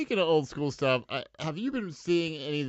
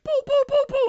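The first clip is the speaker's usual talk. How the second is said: mock punch sounds; 11 dB louder than usual. Johnny beating up street kids.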